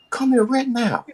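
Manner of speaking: put-on high, firm girlfriend voice; high demanding woman. Woman ordering him back in, high and firm. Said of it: "She said".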